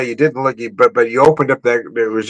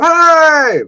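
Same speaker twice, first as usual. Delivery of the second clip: long, high-pitched "hi" called upward; mock yell back; 4 dB louder than usual. Worker yelling back up to the guest, mock holler. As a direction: imitate a distant cheery holler. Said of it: "They'd yell back up".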